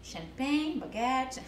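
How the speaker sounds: very slow, spaced-out English; halting confession. Retold or imitated. Telling a customer her only French words, slow and deadpan. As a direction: dole out each word slowly.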